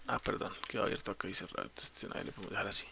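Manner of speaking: very soft, low self-correction; muttered aside. Real, not acted. Instructor fixing a slip mid-explanation, muttered aside. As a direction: drop to a near-inaudible mumble to yourself.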